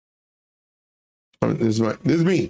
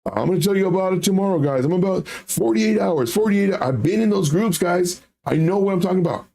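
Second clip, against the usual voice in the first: higher, fast hype pitch; crypto bro; 6 semitones higher than usual. Retold or imitated. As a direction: pump up the crowd like a hype man. Voicing pump group owner.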